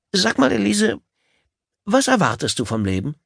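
high, eager date voice; a keen, nervous suitor; eager nervous man. Acted. Gail asks his date.

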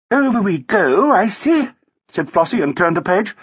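high, airy society woman; lilting, high-voiced lady; chirpy wife. A narrator's reading. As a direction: chatter brightly, pitched well up.

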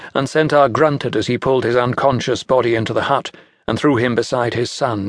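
usual speaking voice

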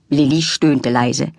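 ordinary delivery, nothing marked

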